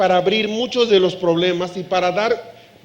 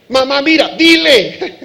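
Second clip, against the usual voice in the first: put-on tattling child; 6 semitones higher, 7 dB louder than usual. Tattletale child calls mother.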